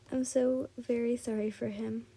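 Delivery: ordinary delivery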